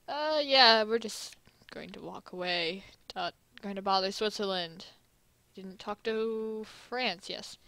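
usual speaking voice

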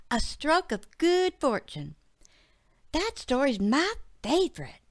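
bright little daughter answering; young girl voice. Acted. Betsy voiced by narrator.

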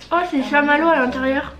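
chewing while talking; speaking between bites of candy; mouth full. Real, not acted. Seen 1: bit the treat just before; mouth working while speaking, treat held up.

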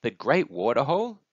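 high, rising question; lightly voiced excited child; amazed little one. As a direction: echo the news in wonder.

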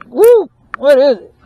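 ordinary talk

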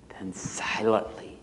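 suspenseful near-whisper; hushed. A narrator's reading. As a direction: drop to a whisper for the stealthy flight. Narrator voices the owl's stealth.

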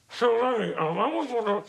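high, put-on tentative voice; mock timid fan. Starstruck passenger beside [name], high mock-timid question.

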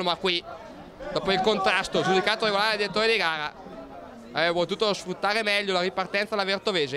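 ordinary talk, nothing marked

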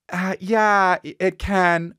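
high, hesitant sing-song reassurance; unconvincing nurse. Retold or imitated. Nurse answering worried parents, unconvincingly. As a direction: say yes without any conviction, pitched high. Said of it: "The way she's saying it, though".

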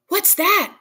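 high, loud frightened question; jolted awake by a knock; startled cry. A narrator's reading. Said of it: "cried the old woman".

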